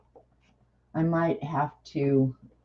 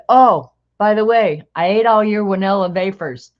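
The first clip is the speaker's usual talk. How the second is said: imitated German accent, loud opening; thick German accent; 4 semitones higher, 7 dB louder than usual. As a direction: announce it like a German coworker. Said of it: "thick German accent".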